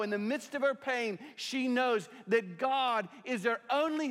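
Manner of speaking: high, loud preacher's voice voicing Hannah's anger; preaching at full force. Pastor preaching to the congregation about Hannah, full force. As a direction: preach it out, raising the voice over the room.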